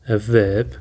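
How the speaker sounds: ordinary delivery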